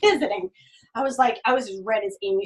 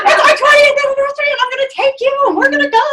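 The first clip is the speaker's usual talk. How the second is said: much higher and louder, elated; thrilled announcement; 13 dB louder than usual. Retold or imitated. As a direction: tell the husband breathlessly. Tells sleepy husband about cruise.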